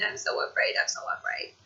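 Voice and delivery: whispered, scared repetition; frightened whisper. Retold or imitated. Calling her cousin from the emergency room, whispering scared. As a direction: breathe it out in fear.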